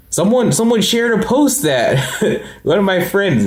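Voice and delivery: laughter spilling into high words; can't hold back a laugh as he starts the story; laughing while telling. Real, not acted. To viewers about a friend's shared post, laughing.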